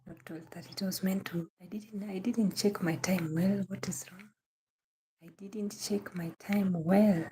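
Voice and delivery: muttered self-reproach in a whisper; whispered to herself. Real, not acted. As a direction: whisper it, almost to yourself. Whispers to herself about mistake.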